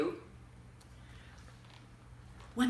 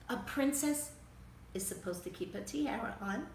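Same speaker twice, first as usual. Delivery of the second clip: usual speaking voice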